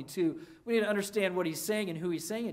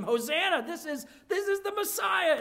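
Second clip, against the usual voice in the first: mock crowd praise; 10 semitones higher, 3 dB louder than usual. Crowd praising Jesus, retold.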